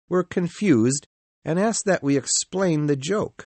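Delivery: plain reading voice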